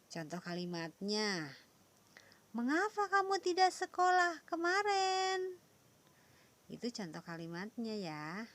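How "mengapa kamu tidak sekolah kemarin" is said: "Mengapa kamu tidak sekolah kemarin?" much higher and louder; exaggerated questioning tune; question intonation. Shown as a demonstration. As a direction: ask it like a teacher demonstrating a question. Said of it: "Intonasi dalam kalimat tanya berbeda".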